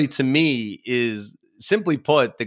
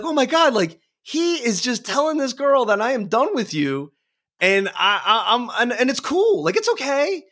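much higher, giddy amazement; excited retelling; 11 semitones higher than usual. Retold or imitated. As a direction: gush at the song's cheeky line. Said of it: "and being like".

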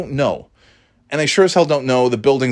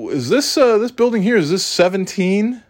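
a much higher, hesitant voice for the guy; high voiced lost stranger; 11 semitones higher than usual. Narrator mimics a lost driver in a higher voice. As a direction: play the confused stranger asking directions. Guy asking about building number.

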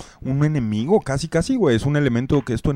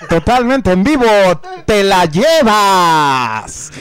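ad announcer; 7 semitones higher, 5 dB louder than usual. Acted. Hyped merch commercial read.

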